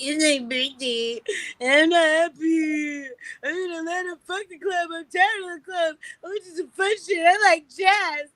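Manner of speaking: high, drawn-out tipsy wail; drunken whining. Retold or imitated. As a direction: whine it like a drunk birthday girl, stretching the words. Drunk birthday complaint to friend on FaceTime.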